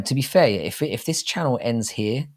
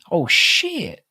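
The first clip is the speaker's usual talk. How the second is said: high, startled outburst; jolted by what he just saw; sudden shocked exclamation; surprised; 13 semitones higher, 5 dB louder than usual. Real, not acted. Reacting to a surprising chat message.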